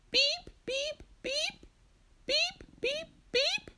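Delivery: very high, piercing electronic beeps; imitated beeping. Storyteller voicing the noise Marcia hears, high beeping. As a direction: mimic an alarm in groups of three.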